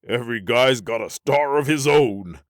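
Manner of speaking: loud, high, giddy outburst; after laughing. Acted. Johnson mocking the split after laughing, loud giddy voice. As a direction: crow it as laughter subsides. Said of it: "said when he stopped laughing".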